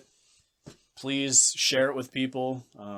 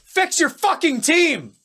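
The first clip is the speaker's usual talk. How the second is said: sudden full-throated, high-pitched shout; big yell; 9 dB louder than usual. Real, not acted. Podcast host signing off with a rant at the team, big yell. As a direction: yell it out as a furious demand. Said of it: "there's your big yell".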